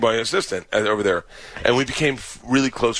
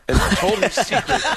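higher, breaking into laughter; laughing confession; 7 semitones higher, 3 dB louder than usual. Real, not acted. Comic confesses he leaked a secret, breaking into laughter. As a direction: blurt it out while cracking up.

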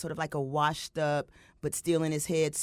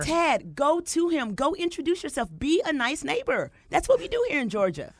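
urging; 8 semitones higher than usual. Acted. Urging co-host to meet neighbor.

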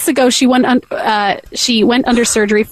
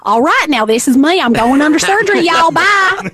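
hammed-up Texas accent rising higher; 4 semitones higher than usual. Mom says goodbye before surgery, exaggerated Texas accent. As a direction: play a Texan mom, climbing to a high goodbye. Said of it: "So f-, do Texas first".

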